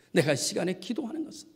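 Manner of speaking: ordinary talk, nothing marked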